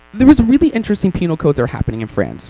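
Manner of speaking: normal talking voice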